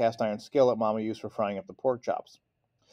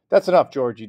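firm but holding back laughter; stern words, laugh leaking through; stifling a laugh; 3 semitones higher, 10 dB louder than usual. A narrator's reading. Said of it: "trying to stifle his own laugh".

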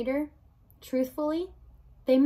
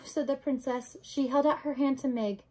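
usual speaking voice

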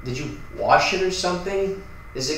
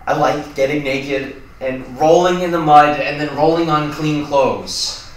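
loud outburst, dragging out at the end; blurted confession; 7 dB louder, slower than usual. Acted. Cornered roommate confessing a secret, blurting it out. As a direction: let the secret burst out loudly.

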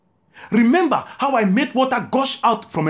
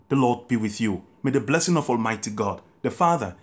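blessing; 6 semitones lower than usual. Acted. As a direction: bless the people gently, then speed up toward amen. Gives the final blessing.

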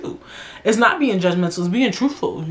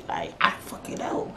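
whisper; 10 dB quieter than usual. Real, not acted. Frustrated aside.

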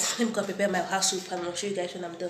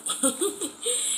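high delighted giggle; 12 semitones higher than usual. Real, not acted. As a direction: burst into a giggle. Laughs showing baby.

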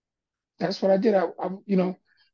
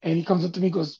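usual speaking voice

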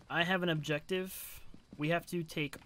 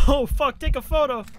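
urgent shout; 8 semitones higher, 10 dB louder than usual. Real, not acted. Ghost appears, urging teammates.

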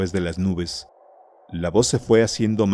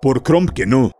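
loud, higher, forceful oath; sworn oath; 6 semitones higher, 5 dB louder than usual. Acted. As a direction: swear it out hard like a barbarian king. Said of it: "juró el rey".

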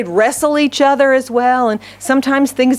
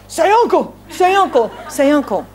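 raised-pitch, repeated taunt; mock bullying demand; 4 semitones higher than usual. God imagined pushing us in the dirt, mock taunt. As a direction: press for surrender, starting high and easing down.

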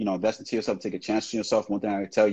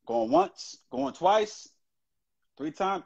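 higher-pitched going-once call; auction-style countdown; 9 semitones higher, much slower than usual. Real, not acted. Host waiting on the chosen winner, high singsong countdown.

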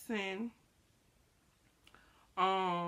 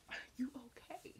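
soft breathy whisper, pitched up; whispered worried question; 5 semitones higher, 12 dB quieter, faster than usual. Retold or imitated. Whispering to a friend in a bad mood, anxious. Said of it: "And I'm like".

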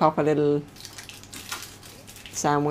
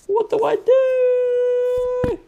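squeaky falsetto; much slower than usual. Acted. High falsetto exclamation.

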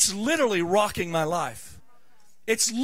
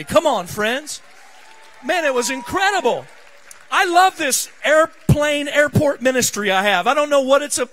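louder, higher, pushing it to the congregation; full-force preaching; 4 semitones higher, 8 dB louder than usual. Acted. Pastor firing up his congregation, full-force preaching. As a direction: preach it out, riding the excitement.

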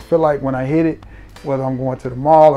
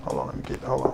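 hushed, softer friend's voice begging Trey to listen; Sam Broom impression; 8 dB quieter than usual. Friend Sam raving to Trey about the fragrance, hushed impression. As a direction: quietly play the hyped buddy. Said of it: "my little Sam Broom impersonation".

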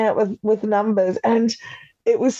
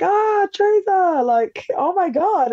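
excited greeting; 10 semitones higher, slower than usual. Retold or imitated. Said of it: "It's like".

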